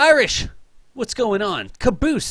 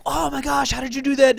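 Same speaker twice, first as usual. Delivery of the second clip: put-on high, wide-eyed kid gasp; lightly voiced child in awe; amazed kid; 11 semitones higher than usual. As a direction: kids amazed by the science trick. Kids amazed by the trick.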